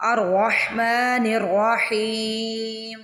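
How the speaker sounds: slow melodic chanted recitation; Quran recitation. Shown as a demonstration. Teacher recites the second verse to students, slow melodic chant. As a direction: chant it slowly like tilawat. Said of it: "تو میں نے یہاں پہ کیا کیا؟".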